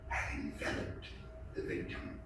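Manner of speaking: soft, unvoiced whisper; hushed, menacing whisper; whispered dread. A narrator's reading. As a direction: breathe it like a secret of death.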